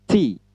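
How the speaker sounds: "T" with a hissing burst of air; tongue behind upper teeth for a puffed "T"; aspirated English T. Shown as a demonstration. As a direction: push trapped air out on "T". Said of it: "Lalu ucapkan".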